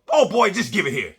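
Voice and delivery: put-on high, loud old grandma snapping at her son; feisty grandma taking over; grandma's voice. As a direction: play the impatient grandmother. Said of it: "And my grandma goes".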